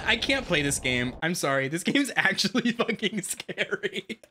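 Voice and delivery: ordinary talk, nothing marked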